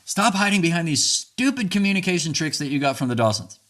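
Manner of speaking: the unhealthy version, played as a loud accusation; mock angry outburst. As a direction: throw the accusation at your partner in a hammed-up fight. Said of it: "how's this one for a last one".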